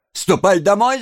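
high, sharp order; scolding woman. A narrator's reading. Second woman sending Oliver home, scolding.

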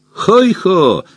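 high, jolly rabbit whoop; pleased exclamation. A narrator's reading. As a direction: call it out high, thoroughly pleased. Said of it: "довольно воскликнул кролик". (довольный возглас высоким голосом)

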